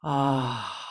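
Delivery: long open-mouthed sighing exhale; deep sigh. Shown as a demonstration. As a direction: let out a deep, relaxing "ah" sigh. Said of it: "exhale with a deep sigh".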